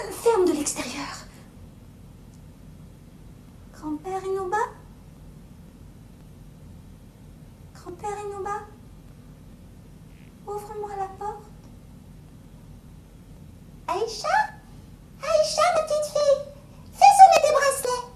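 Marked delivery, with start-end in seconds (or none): 3.7-11.4 put-on high, uncertain child's call; high hesitant girl voice; little girl calling. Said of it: "Aïcha arrives at door". 13.9-18.1 very high, loud strange voice; high, loud fake grandfather; ogre imitating grandfather. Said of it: "Jamais son grand-père n'avait parlé comme ça".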